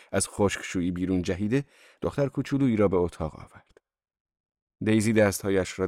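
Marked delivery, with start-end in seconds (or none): none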